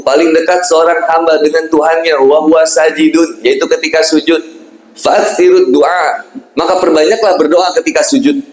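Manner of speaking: ordinary talk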